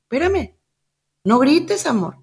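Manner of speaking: imitating a gentle mother soothing her toddler; mother hushing a tantrum, soft and high. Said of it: "la mamá le dice".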